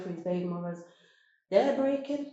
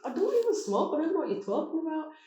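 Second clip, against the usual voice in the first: high, louder protest; higher, pushed-up denial; indignant refusal; 10 semitones higher, 3 dB louder than usual. Retold or imitated. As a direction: refuse him, voice pitched way up. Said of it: "I said".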